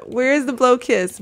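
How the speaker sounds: ordinary talk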